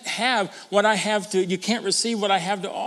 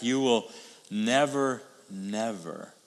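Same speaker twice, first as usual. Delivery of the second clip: slow, each never falling, the last much softer; emphatic never; 6 semitones lower, 4 dB quieter, slower than usual. Shown as a demonstration. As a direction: lean on each never, then let it drop. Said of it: "Notice the emphatic".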